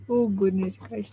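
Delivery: normal talking voice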